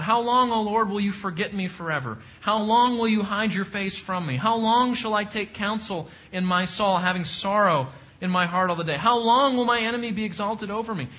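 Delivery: reading the psalm's cries with rising force; psalm read as a heartfelt lament; lament read aloud. Acted. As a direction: plead it like the psalmist crying out.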